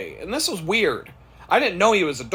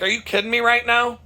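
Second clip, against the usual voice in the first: high-pitched incredulous comeback; retold disbelief; 9 semitones higher, 3 dB louder, faster than usual. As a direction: repeat it in amused disbelief, voice raised in pitch. Repeats the retold comeback after laughing.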